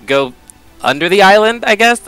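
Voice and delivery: normal talking voice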